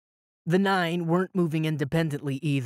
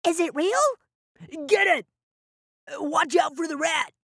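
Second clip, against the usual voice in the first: excited crowd shouting; 12 semitones higher than usual. Acted. Crowd shouting over money.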